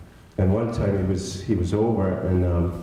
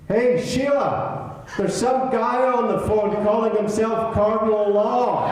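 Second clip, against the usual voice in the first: shouting upstairs; 14 semitones higher, slower than usual. Retold or imitated. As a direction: bellow her name up the stairs. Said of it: "he shouts like this".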